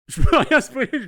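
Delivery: dit en éclatant de rire, fort et haut; lâche-le en riant franchement. Real, not acted. Rire en réagissant au chat. (éclat de rire)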